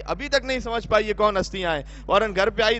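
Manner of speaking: usual speaking voice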